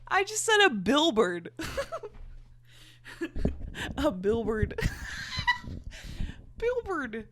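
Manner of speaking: giggling through the words; laughing. Real, not acted. Cracking up at the word billboard while reading aloud. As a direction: laugh as you repeat it. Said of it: "it's so funny".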